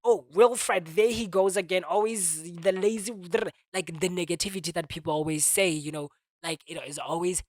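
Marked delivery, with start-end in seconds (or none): 0.0-3.1 louder mock nagging voice; mock nagging onlookers. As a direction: scold like a tired critic. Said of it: "rather than".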